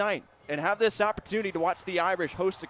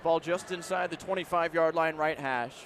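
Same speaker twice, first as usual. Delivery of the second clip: usual speaking voice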